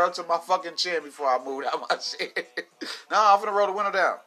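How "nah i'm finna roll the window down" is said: fast, higher and louder brag; bragging about staying in his flooded car, fast and loud; excited boast. Pretend. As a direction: fire it off fast, laughing defiance.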